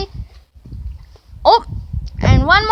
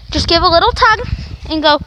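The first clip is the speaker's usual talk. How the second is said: ordinary delivery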